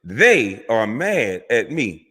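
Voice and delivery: very slow, each word set apart; word-for-word quote. Retold or imitated. Commentator quoting a doctor's own words to make a point, slow and deliberate. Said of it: "He, in his own words".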